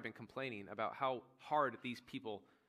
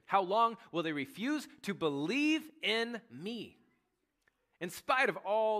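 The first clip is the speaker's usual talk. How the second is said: pained divine lament; 6 semitones higher, 6 dB louder, slower than usual. Acted. God's hurt complaint, voiced.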